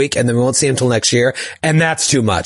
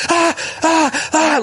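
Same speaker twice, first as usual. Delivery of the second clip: high, startled yelps; retold shriek; 13 semitones higher, slower than usual. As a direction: squeal in disgust at the bug. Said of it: "One time I was like".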